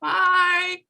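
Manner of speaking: ordinary talk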